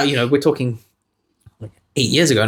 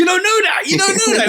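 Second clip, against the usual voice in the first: mocking echo. Mocking friend's objection.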